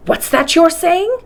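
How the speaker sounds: high, slow, stunned exclamation; the professor stunned by the news; exclaimed with emotion. Acted. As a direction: overcome with sudden emotion. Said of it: "he exclaimed with indescribable emotion".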